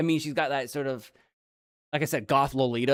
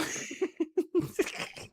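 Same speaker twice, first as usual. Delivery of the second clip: high giggle; 5 dB quieter than usual. Acted. Chef giggle.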